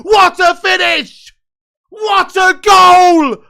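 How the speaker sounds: goal celebration shout, high and loud; long goal yell. Real, not acted. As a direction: roar it like a commentator after a screamer. Streamer watching Iran score late.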